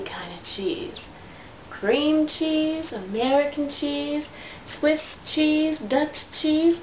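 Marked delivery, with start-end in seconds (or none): 0.0-1.0 secretive storybook whisper. Acted. Mom reading to her daughter, whispered aside. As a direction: lean in and whisper it. Reading picture book aloud.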